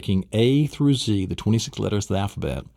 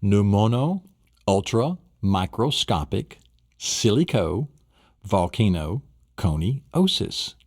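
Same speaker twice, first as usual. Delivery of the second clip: slow syllable drill. Shown as a demonstration. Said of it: "I'll say it one more time".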